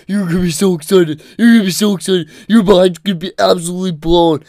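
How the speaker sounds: breathless mock fanboy promise; mock excited friend. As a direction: tease him by hamming up his eagerness. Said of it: "that's what you sound like".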